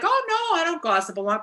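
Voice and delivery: denying gossip to a questioner, defensive; defensive denial. Retold or imitated. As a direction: brush off the question indignantly. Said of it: "I'd be like".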